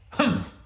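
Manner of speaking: high, clipped humph of a grumpy bear; grumpy bear's humph; grumbled humph. Acted. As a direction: snort it dismissively. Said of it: "Bear grumbled".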